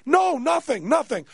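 high-pitched insistent protest; exasperated denial. Retold or imitated. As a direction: imitate frantic innocence. Insisting on innocence to doctor.

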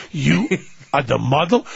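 mock Italian accent, appalled and halting; pure disgust. Makeup man repeating his disbelief, pure disgust. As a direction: split the question with a disgusted pause. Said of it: "it's just pure disgust".

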